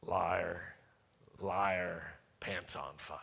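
low, hushed liar taunt; softly dropped playground jeer; muttered mock taunt. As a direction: drop it low and quiet, like a teasing aside. Mock retort to own promise.

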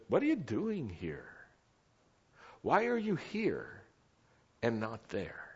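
softly voiced questions, lightly acted; gently question, keeping the volume down; quiet divine voice. God's quiet voice questioning Elijah, softly acted. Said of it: "when that voice was speaking to Elijah".

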